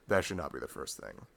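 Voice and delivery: ordinary delivery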